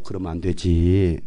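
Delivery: low, soft, drawn-out admonishing; slow, quiet, overly mild chiding; mock-gentle parent. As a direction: imitate a too-patient father. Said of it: "화낼 때는 화내는 거예요".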